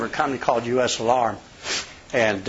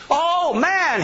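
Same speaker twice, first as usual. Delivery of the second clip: much higher, scoffing exclamation; hammed-up mock dismay; pushy rival salesman; 14 semitones higher than usual. Retelling a rival salesman at the door, hammed-up scoff. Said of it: "he says".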